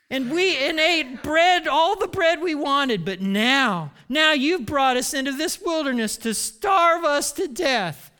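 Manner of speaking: high, loud mock wail; hammed-up accusing whine; moaning complaint. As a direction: pile up the grievance melodramatically. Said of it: "they moaned".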